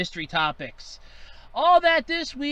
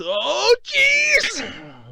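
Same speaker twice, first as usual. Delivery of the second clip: shouted exclamation; 12 semitones higher, 5 dB louder than usual. Acted. Exclaims after the stairs.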